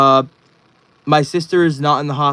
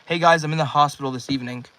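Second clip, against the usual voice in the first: ordinary delivery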